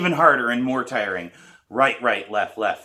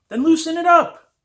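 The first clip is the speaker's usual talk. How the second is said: much higher, louder order; firm command; 10 dB louder than usual. A narrator's reading. Teacher telling the tense student to loosen up, loud. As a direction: bark the instruction.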